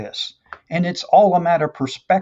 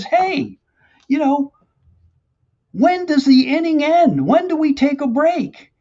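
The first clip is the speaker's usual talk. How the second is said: retold mild yell; 7 semitones higher than usual. Pitcher asking Satan for a break, mild retold yell. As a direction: call up into the dugout, half-hearted yell. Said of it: "he kinda yells in there".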